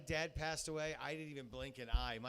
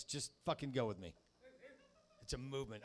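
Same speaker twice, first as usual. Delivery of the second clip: ordinary talk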